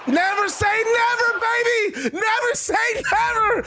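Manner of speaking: screaming high and hyped, near a cry; ecstatic shout. Real, not acted. Gamer cheering Nikki Bella's kickout, ecstatic high shouting. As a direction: lose it completely over the kickout. Calling a dramatic kickout live.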